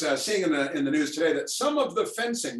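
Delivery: normal talking voice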